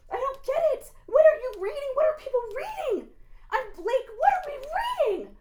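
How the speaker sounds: can't believe what they read; incredulous. Real, not acted. Preacher to the deceivers, high incredulous disbelief.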